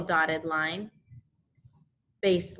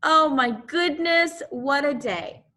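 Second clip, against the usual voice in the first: mock exclamation; 6 semitones higher, 3 dB louder than usual. Teacher exclaims after announcing test.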